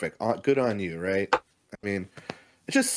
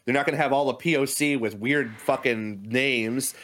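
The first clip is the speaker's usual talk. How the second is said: ordinary delivery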